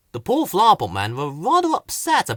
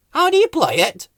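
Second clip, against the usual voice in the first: higher, louder question; a touch of an eager high voice; lightly voiced keen question; lightly voiced Whoops; 13 semitones higher, 5 dB louder than usual. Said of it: "said Whoops".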